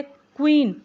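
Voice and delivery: क्वीन with a clear kw at the start; kw sound. Shown as a demonstration. Teacher modelling the kw sound for students. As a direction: say क्वीन opening on kw. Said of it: "अब यहाँ पे आवाज़ आ रही है क्व की".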